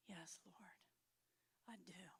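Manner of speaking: hushed, whispered yes; whispered surrender. Retold or imitated. Praying woman whispering her yes to God. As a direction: breathe it out in surrender.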